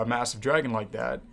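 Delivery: ordinary talk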